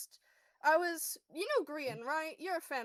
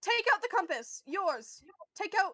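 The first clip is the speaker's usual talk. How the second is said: urgent pleading; 5 semitones higher, 7 dB louder than usual. Acted.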